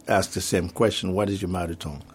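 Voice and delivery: ordinary delivery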